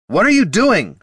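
high, loud demanding question; indignant demand. A narrator's reading. Bard to wounded friend grabbing the healer, loud demand. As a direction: demand an answer, alarmed. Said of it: "Heraldon demanded".

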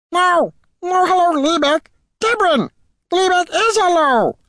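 very high, loud goblin squeal; excited goblin. A narrator's reading. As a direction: yell it back as an over-eager goblin. Goblin shouts corrections enthusiastically.

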